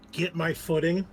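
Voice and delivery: usual speaking voice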